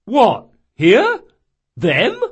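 loud, high startled questions; astonished sergeant. Acted. Watch sergeant seeing the boys, loud astonished questioning. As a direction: blurt it in disbelief.

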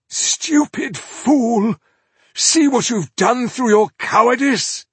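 high, loud furious sorcerer's curse; cursing Magus. Acted. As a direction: spit the words at her in fury. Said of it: "The Magus cursed Koira".

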